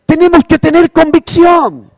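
high, loud preacher's exhortation; full-force preaching. Acted. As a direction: drive the conviction home to the church. (predicación a todo pulmón, aguda y fuerte)

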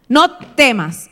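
loud, slow NO TEMAS; firm command. Acted. Preacher quoting God's order to the church, commanding "No temas". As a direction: order it: "No temas", each word weighted. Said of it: "es una orden, es un mandato".

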